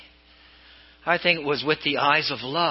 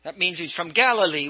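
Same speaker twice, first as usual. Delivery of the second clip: ordinary talk, nothing marked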